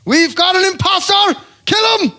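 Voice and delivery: very high, loud hammed-up cry; alarmed official. Immigration officer spotting an imposter, high mock shout. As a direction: shriek the alarm in mock outrage. Acts officials catching the fake Irishman.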